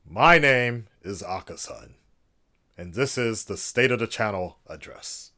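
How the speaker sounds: loud, formal, announcer-style address voice; mock presidential address. Vlogger parodying a presidential speech to viewers, grand and formal. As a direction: play a head of state addressing the nation. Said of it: "I don't know why I keep doing this accent".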